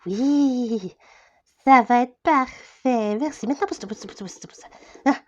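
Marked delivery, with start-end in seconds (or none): 3.5-4.7 rapid repeated mutter fading to a whisper; quiet, insistent whispering; hushed shooing. Acted. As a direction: shoo them aside under your breath. Shooing someone aside under breath.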